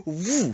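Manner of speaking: high, loud "woo"; swooping sound effect for a head plunge; whoosh of a dunk. Retold or imitated. Imitated dunking sound in story.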